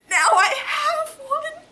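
loud, high, drawn-out delight; overjoyed. Real, not acted. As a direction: stretch the words out in amazed joy. Overjoyed at replacement candle.

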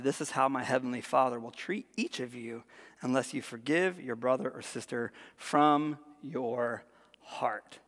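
low, soft, slowing at the end; grave reading. Real, not acted. Preacher reading Jesus' warning to the congregation, quiet gravity. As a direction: let the last words sink to near-whisper.

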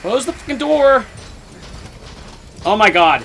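loud high panicked shouts; terrified screaming. Real, not acted. Gamer fleeing the monster, loud terrified screams. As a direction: scream for your life while running.

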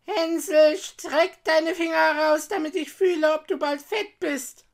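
put-on high, loud old witch voice; witch calling out. A narrator's reading. Witch at the stall checking on Hänsel, calling loudly. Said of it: "schlich die Alte zu dem Ställchen und rief".

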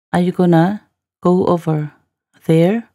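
teacher's careful phrase-by-phrase repeat. Shown as a demonstration. Teacher repeats line in slow chunks.

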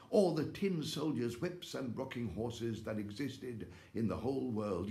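ordinary delivery, nothing marked

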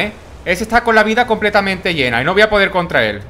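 ordinary talk, nothing marked

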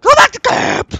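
panicked high shouting; scared giddiness. Real, not acted. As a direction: yell the retreat, voice cracking. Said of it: "My voice just, just was, just ran".

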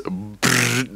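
rolling brrr of a war machine; mock rumble sound; imitated rumble. Imitating Titan horn rumble.

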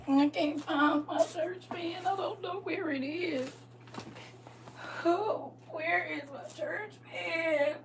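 high, soft, drawn-out wail; slow, high put-on fretting; mock helpless lament. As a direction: plead for the lost fan in a high little voice. Acted lament over lost fan.

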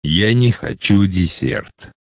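usual speaking voice

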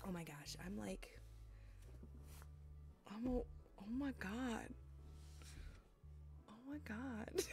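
breathless stunned whisper; awed hushed whisper. Real, not acted. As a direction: whisper it in disbelief. Stunned by the raid.

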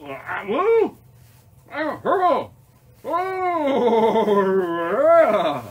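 loud, high whooping outburst; wild delight. Pretend. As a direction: whoop and holler with playful abandon. Wild acted reaction to eating rainbow.

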